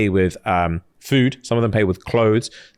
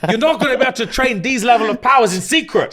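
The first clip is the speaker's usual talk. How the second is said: firm, much higher-pitched warning; stern refusal; 8 semitones higher than usual. Retold or imitated. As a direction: forbid her to train, insistent. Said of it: "He was like".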